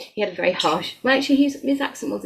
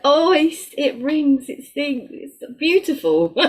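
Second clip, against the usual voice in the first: delighted, higher and louder praise; gushing; 8 semitones higher, 7 dB louder, slower than usual. Real, not acted. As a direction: rave about his accent with real delight. Gushing over Simon's accent.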